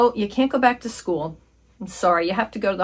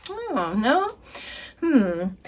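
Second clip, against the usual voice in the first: put-on high valley girl voice; valley girl teen; 3 semitones higher, much slower than usual. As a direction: play a teen patient, all "like". Patient role continues.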